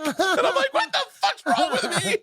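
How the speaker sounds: very high, hammed-up wailing through laughter; mock crying. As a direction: squeal it like a comic sobbing meltdown. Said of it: "I start crying".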